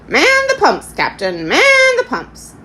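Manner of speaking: very high, loud parrot squawk; hoarse parrot. A narrator's reading. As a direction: squawk an order like a ship's parrot. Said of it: "remarking hoarsely".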